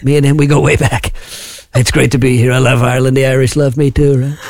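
boastful American politician impression; self-praising impression with tagged-on checks for agreement; Trump. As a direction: brag in a put-on American presidential voice. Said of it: "Because I'm Trump".

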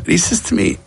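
ordinary talk, nothing marked

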